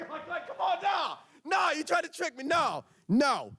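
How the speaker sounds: high indignant shout; indignant squeal. Retold or imitated. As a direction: squeal that you got tricked. Tricked by nephew.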